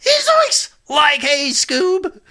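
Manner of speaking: very high, cracking cartoon slacker voice; Shaggy. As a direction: squeal it like Scooby-Doo's goofy pal. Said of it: "I can do the Shaggy voice".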